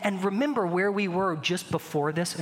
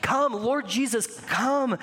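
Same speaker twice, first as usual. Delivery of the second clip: crying out; 5 semitones higher, much slower than usual. Acted. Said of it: "who are crying out".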